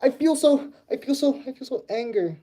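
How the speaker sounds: high-pitched playful fuming; hammed-up little tantrum; mock anger. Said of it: "I'm so mad".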